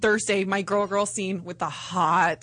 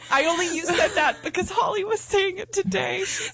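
laughing; 10 semitones higher than usual. Real, not acted. Embarrassed justification.

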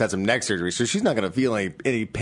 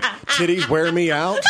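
laughing; 4 semitones higher, much slower than usual. Pretend. Mock exhausted quote, laughing.

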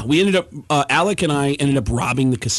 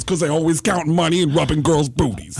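put-on eccentric comic voice; Tracy Morgan; 2 semitones higher than usual. Star comic answers his own riddle, Tracy Morgan impression. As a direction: deliver a punchline as an imitated oddball star. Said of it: "And he goes".

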